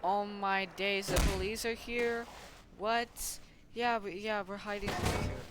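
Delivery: mock alarm. Figures hiding as the police arrive, mock alarm. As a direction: answer in pretend dismay.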